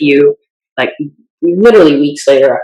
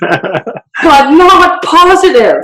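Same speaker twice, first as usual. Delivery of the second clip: very slow, high and drawn out with amusement; laughing concession; 11 semitones higher, much slower than usual. Real, not acted. Guest admitting serial killers aren't positive, laughing.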